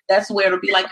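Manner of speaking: ordinary talk, nothing marked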